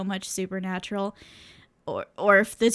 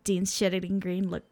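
usual speaking voice

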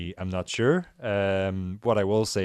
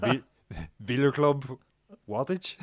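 Flemish accent, a little higher; 6 semitones higher than usual. Shown as a demonstration. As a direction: say it like a Flemish speaker. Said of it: "can you say that with a Flemish accent for me".

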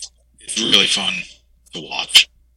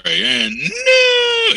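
much higher, stretched slow shout of "and no!"; Finkel's title announcement. Retold or imitated. Co-host imitating Finkel announcing a new champion, slow high shout. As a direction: belt it like a ring announcer crowning a new champ. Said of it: "you know the Fink".

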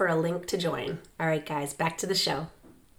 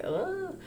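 cracked high croak from a failed yell; a broken voice squeak; voice cracking; 13 semitones higher, 7 dB quieter than usual. Retold or imitated. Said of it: "forgetting that my voice was like".

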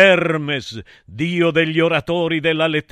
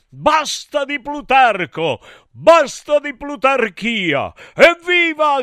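high, theatrical rallying shout; high-pitched chanted outcry; mock protest cry; 7 semitones higher, 3 dB louder than usual. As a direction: call it out like a slogan, rising to a cheer. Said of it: "gridò dopo di me".

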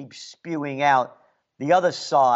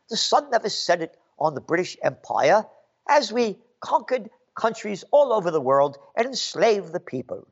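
usual speaking voice

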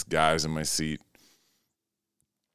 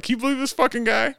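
high-pitched, quick mock disbelief; incredulous aside; faster than usual. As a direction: retell it in a much higher voice, incredulous. Retold incredulous aside to his friend.

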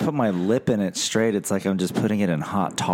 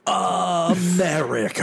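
high, slow proud "America"; mock patriotic; 9 semitones higher, slower than usual. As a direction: proclaim it like a flag-waver.

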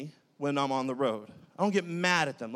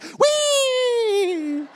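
long, very high, loud "whee"; kids' squeal; 6 dB louder than usual. Acted. Kids in the whipped-around car, long high squeal. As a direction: squeal it out like delighted children.

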